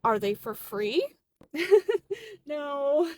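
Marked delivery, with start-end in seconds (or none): 1.5-2.4 short, higher, louder chuckle; awkward laugh. Acted. Cashier asked for free donuts, incredulous chuckle. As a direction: laugh off the request.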